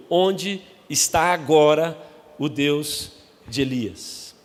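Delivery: stretched, slow searching cry; slow dramatic cry. Acted. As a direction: drag out the question. Quoting Eliseu's cry.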